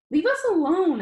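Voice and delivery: sudden high, loud outburst; yelling. Acted. Boy telling the teasing bullies to go, yelling. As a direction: snap at them to back off, loud and high. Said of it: "by yelling at them".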